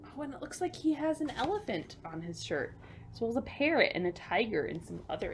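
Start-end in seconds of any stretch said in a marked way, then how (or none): none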